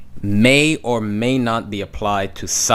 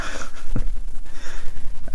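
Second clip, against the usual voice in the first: laugh. Real, not acted. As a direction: burst of real laughter. Teacher laughing mid-lesson.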